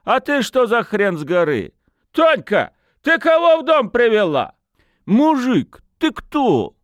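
put-on high, loud drunken challenge; rowdy lad picking a quarrel; outraged yelling. A narrator's reading. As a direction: demand answers at a high pitch. Lad confronts strange man, calls woman.

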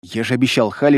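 usual speaking voice

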